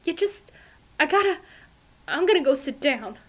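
high, shaken breathless voice; rattled and gasping; breathless fright. A narrator's reading. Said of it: "her heaving chest".

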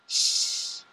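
unvoiced hushing shush; whispered shush. Olga hushing Ray while they hide, whispered shush. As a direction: hush him without a sound. Hiding under the desk.

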